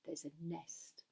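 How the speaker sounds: ordinary delivery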